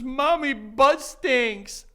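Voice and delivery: mild mock toddler wail; high-pitched imitation of an upset child; crying little girl. Daughter pointing at mom on the couch, mock crying. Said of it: "She starts hysterically crying".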